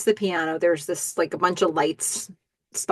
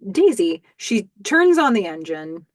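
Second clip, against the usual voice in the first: ordinary talk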